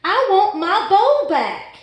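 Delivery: high, loud, slow wail; loud desperate scream. A narrator's reading. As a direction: demand it back at full volume. Fish screams after screaming line.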